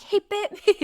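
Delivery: high-pitched, half-laughing retold protest; belated protest. Bitten runner calling after the dog's owner too late, high retold protest. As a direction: imitate your own stunned, too-late objection.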